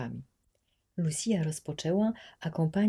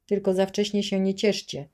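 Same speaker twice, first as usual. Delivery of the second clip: loud gloating threat, pitched up; threatening; 5 semitones higher, 9 dB louder than usual. A narrator's reading. Gendarme threatens the children not to rejoice, loud. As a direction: sneer it down at the prisoners. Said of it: "groził żandarm".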